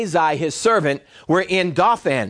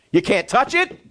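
usual speaking voice

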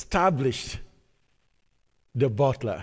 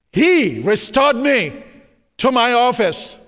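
preaching shout; 7 semitones higher, 6 dB louder, slower than usual. Real, not acted. Preacher climaxes the verse.

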